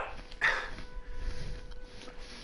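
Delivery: soft mischievous imp cackle; a breathy little gleeful imp laugh; imp's laugh. Acted. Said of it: "Laughs the imp".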